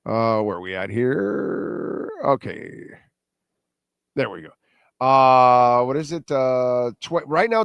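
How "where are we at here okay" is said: normal talking voice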